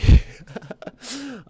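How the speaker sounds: loud sudden laugh; loud burst of laughter. Real, not acted. Laughs after singing.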